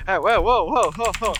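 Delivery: rising alarmed whoas. Real, not acted. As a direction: pull them back in alarm. Alarm at nuke plan.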